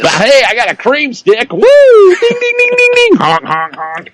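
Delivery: goofy DJ ding-ding and honks; silly clown. Pretend. Clowning morning DJ sound effects.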